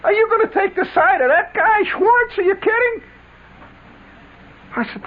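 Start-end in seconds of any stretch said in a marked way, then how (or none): 0.0-3.0 very high, squeaky mock disbelief; incredulous Flick. Flick turning to the narrator in the car, mock outraged. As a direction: squeal it like you can't believe it. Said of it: "Flick turns around to me and says".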